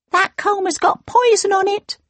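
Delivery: high, alarmed little dwarf; dwarf crying out. Acted. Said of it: "cried the second dwarf".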